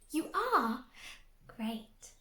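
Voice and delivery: usual speaking voice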